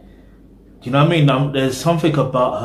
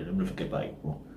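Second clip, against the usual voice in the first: muttered aside; 6 semitones lower, 15 dB quieter, faster than usual. Real, not acted. Quick muttered aside to himself.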